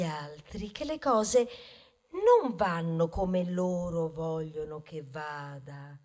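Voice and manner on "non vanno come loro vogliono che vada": normal talking voice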